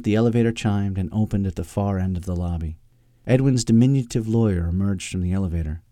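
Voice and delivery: ordinary delivery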